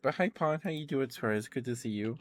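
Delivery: high, bright greeting to a friend; excited greeting. Real, not acted. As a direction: welcome them in happily. Greeting a viewer on stream.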